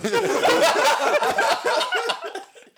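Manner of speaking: high loud laughing burst; cracking up; loud laughter. Real, not acted. Laughing fit.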